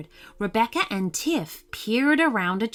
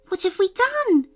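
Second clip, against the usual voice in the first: higher, louder fairy cry of dismay; exclaimed; 13 semitones higher, 5 dB louder than usual. Acted. Rebecca to Tiff after eating the troll's soup, a high dismayed exclamation. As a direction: burst out as loud as she dares. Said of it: "exclaimed Rebecca as loudly as she dared".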